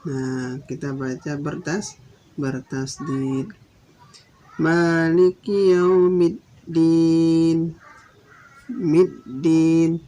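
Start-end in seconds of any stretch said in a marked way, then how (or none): 4.6-10.0 Yaumiddin with the dd held and stressed, slow and loud; doubled d in Yaumiddin. Shown as a demonstration. Teacher showing the doubled d, loud slow Yaumiddin. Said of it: "ada tandanya tasdid".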